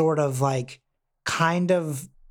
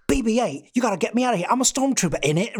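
higher-pitched, exaggerated British accent; John Boyega's British caricature; 7 semitones higher, faster than usual. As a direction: ham up a London British accent. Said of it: "going for a caricature".

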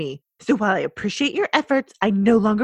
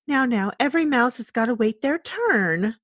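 normal talking voice

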